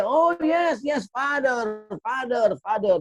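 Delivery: very high, slow delighted Indian English; eager shopkeeper finally understands. Retold or imitated. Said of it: "kata orang India".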